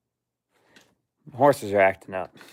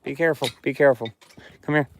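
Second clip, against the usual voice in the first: ordinary talk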